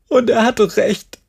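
crying it out as it overflows; tearful outburst. Acted. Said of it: "Jerry liefen jetzt Tränen über ihr Gesicht".

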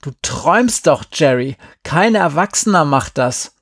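loud, emphatic dismissal; scornful protest. A narrator's reading. Boy tells friend no adult would, scornful cry. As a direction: shoot down the naive plan. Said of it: "rief Glenn".

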